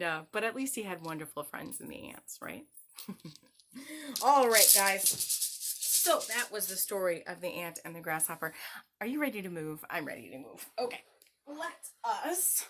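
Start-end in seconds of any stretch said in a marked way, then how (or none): none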